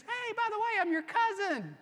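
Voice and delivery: high, laughing offhand confession; sheepish reveal. Retold or imitated. Jacob telling Rachel he is her cousin, high and laughing. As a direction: toss it off with a laugh. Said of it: "he basically says".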